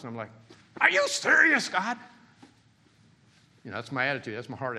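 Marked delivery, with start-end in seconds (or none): 0.8-2.0 high, loud, disbelieving squawk; hammed-up shock at God's request; mock disbelief. Preacher acting his own reaction to God's request.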